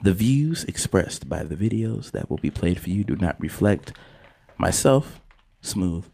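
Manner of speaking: low, hushed, measured announcer delivery; formal disclaimer read. Acted. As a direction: read it like a solemn viewer-discretion warning. Reads show's disclaimer.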